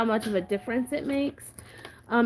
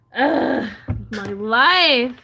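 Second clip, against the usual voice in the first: loud exasperated groan; dramatic sigh; 2 semitones higher, 7 dB louder, much slower than usual. Real, not acted. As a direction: groan out your frustration. Groaning after the spill.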